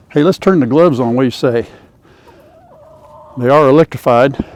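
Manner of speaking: ordinary talk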